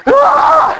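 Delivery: put-on sudden high startled baby cry; imitated baby scream. Preacher mimics daughter's frightened baby cry.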